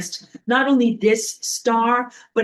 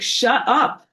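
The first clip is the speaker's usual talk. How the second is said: telling off; 6 semitones higher, 3 dB louder than usual. Pretend. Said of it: "and be like".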